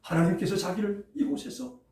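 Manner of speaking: normal talking voice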